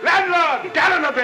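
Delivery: loud high call across the inn. Acted. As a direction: shout the order to the landlord. Calling out to the landlord.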